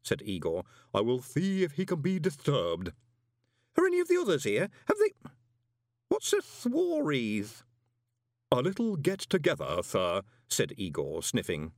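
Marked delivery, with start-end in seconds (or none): 0.9-2.9 formal, put-on servant voice; stately creepy butler; Igor manservant. A narrator's reading. 3.8-7.6 high, loud questions breaking off into puzzlement; flustered man, pitched very high; anxious visitor. A narrator's reading. Tipsy visitor, anxious and confused. 8.5-10.2 polite explanation in a put-on voice; deadpan creepy butler; Igor manservant. A narrator's reading. Igor servant explains to visitor.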